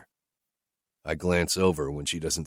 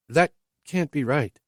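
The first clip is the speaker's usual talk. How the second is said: higher, lightly voiced woman asking bluntly; higher woman's voice, questioning her driver; Whitney's voice; 9 semitones higher than usual. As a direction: lift into her voice, direct and searching.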